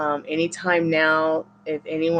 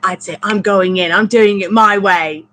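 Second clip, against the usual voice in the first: loud, higher imitation of a rescuer charging in; hammed-up gutsy vow, louder; mock tough declaration; 4 semitones higher, 11 dB louder than usual. Rescuer vowing to storm the restaurant, loud mock bravado. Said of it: "She's like".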